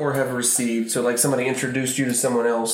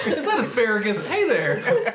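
laughing, high cooing; 10 semitones higher than usual. Retold or imitated. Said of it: "find something in the room".

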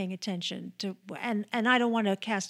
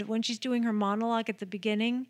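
usual speaking voice

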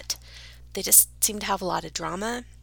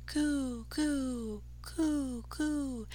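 smug dove coo; 4 semitones higher, 7 dB quieter, much slower than usual. Pretend. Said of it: "smugly mocking him".

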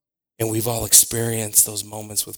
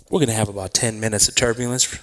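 airline pilot; faster than usual. Acted. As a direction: do a pilot on the intercom. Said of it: "I sound just like a pilot".

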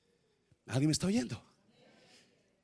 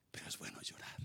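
whisper; 19 dB quieter, faster than usual. Real, not acted. Whispered tender aside.

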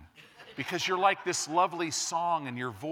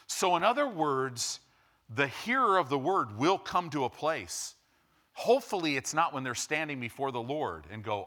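ordinary talk, nothing marked

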